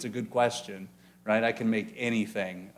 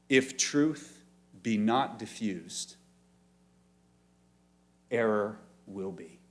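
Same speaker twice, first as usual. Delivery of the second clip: slow quote; slower than usual. Shown as a demonstration. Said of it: "Listen again".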